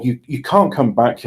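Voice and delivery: ordinary talk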